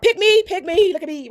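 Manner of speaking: high, eager mock pleading; mock attention-seeking. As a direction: beg to be chosen, mockingly. Mimics attention seekers begging.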